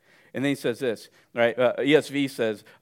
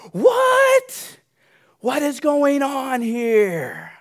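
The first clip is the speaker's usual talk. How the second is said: high, loud, slowed-down shocked cry; loud incredulous what; stunned outcry; 10 semitones higher, 7 dB louder, much slower than usual. Acted. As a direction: gasp it out in disbelief, dragging the question. Preacher acting shocked listener.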